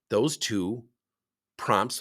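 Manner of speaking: normal talking voice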